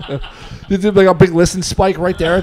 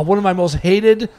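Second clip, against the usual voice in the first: ordinary talk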